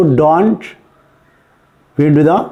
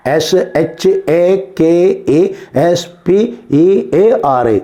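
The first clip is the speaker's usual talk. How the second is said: letter by letter with gaps; slow, separated dictation of each letter; slow spelling; much slower than usual. Shown as a demonstration. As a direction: spell it out slowly for pupils writing it down. Repeats spelling slowly for writers.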